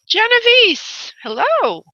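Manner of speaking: high, drawn-out call of the name. Real, not acted. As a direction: call her name down the line, stretched. Calling caller on the phone.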